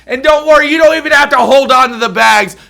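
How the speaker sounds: loud, high ranting yell; mock rant. Real, not acted. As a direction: shout at the TV, fed up. Ranting at wife on screen.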